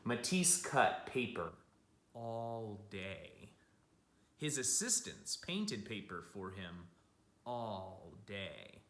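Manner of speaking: gentle picture-book storytelling; storybook voice for kids; reading to children. A narrator's reading. Reads picture book aloud.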